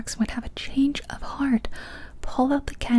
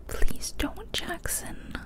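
pleading whisper, barely voiced; whispered plea; 8 semitones higher, 5 dB quieter, much slower than usual. Boy pleading with his thieving friend, whispered. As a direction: beg him under your breath. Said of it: "I whispered".

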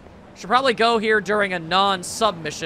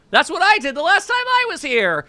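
high, louder, thrilled delivery; excited outburst; 9 semitones higher, 4 dB louder than usual. Acted. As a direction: burst out with delighted recognition. Character answers an astonished waiter.

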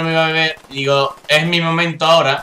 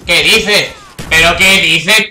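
louder, insistent incredulous question; indignant disbelief; 4 dB louder, slower than usual. Real, not acted. Gamer protesting a missed shot, loud indignant disbelief. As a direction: protest it forcefully.

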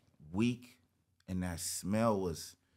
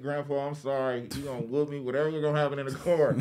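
put-on pleading kid; 7 semitones higher, 4 dB louder, faster than usual. Pleading with grandpa, retold.

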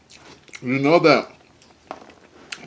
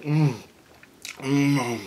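ordinary talk

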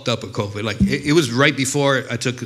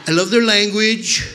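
Trump impression; 5 semitones higher than usual. Comic mimics Trump gushing over Koreans, hammed-up. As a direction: ham up Trump praising the Koreans. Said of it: "totally something Trump would have said".

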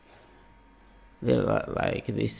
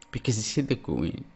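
ordinary talk, nothing marked

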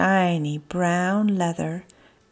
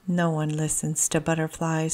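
sneer; 4 semitones lower, 6 dB quieter, faster than usual. Acted. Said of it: "Squirmy sneered".

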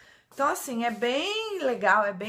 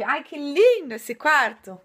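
excited; 4 semitones higher, 8 dB louder, slower than usual. Real, not acted. Sees the Mickey bedroom.

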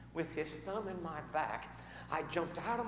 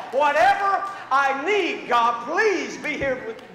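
shouted prayer; 11 semitones higher, 18 dB louder, slower than usual. Retold or imitated. Frantic prayer acted out.

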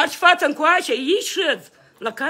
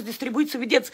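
ordinary talk, nothing marked

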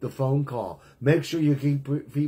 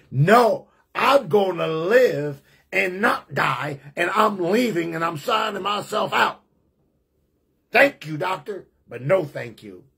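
loud and slow, refusing the doctor; a patient yelling his defiance at the doctor; defiant shout; 4 semitones higher, 3 dB louder than usual. Retold or imitated. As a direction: shout back your refusal.

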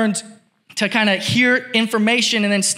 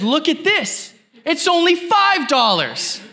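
higher, lightly put-on voice of an excited shopper; imitating the shopper; 9 semitones higher than usual. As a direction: play up the stranger's bargain glee. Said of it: "And he says".

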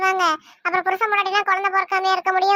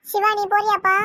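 ordinary talk

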